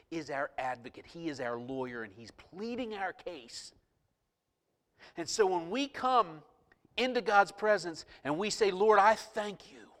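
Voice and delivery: fervent preaching. Real, not acted. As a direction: build to loud, high-pitched praise. Preaches, building to praise.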